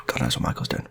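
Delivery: plain reading voice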